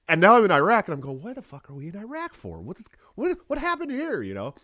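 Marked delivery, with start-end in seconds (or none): 1.2-4.2 high, baffled outburst; incredulous. Retold or imitated. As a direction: ask it like you can't believe where you ended up. Retelling his disbelief in Iraq.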